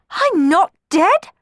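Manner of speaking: very high, loud, drawn-out protest; shocked splutter. A narrator's reading. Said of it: "spluttered Cenerentola, shocked".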